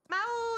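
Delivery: high, loud imitated cat meow. Shown as a demonstration. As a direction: call out to the cat like a cat. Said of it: "Every time I meow".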